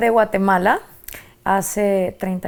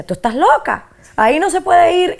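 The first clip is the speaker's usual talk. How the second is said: a hint of the grandmother, higher and louder at the end; imitating grandmother's warning; 8 semitones higher, 4 dB louder than usual. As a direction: lightly voice abuela scolding a curious child. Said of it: "Y me decía".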